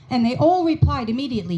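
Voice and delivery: ordinary talk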